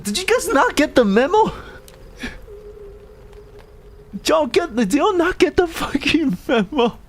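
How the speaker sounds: high-pitched, upset demand; pleading, worked-up complaint; exasperated protest. Real, not acted. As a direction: scold them in a high, incredulous voice. Upset scolding of viewers.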